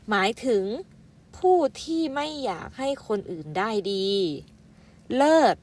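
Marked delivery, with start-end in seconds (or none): 5.1-5.6 headword called out. Shown as a demonstration. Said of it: "อ่านว่าเลิศ".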